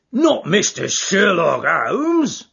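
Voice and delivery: slow, loud astonished roar. Acted. Prizefighter recognising Holmes, slow astonished roar. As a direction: bellow it out in disbelief. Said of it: "roared the prize fighter".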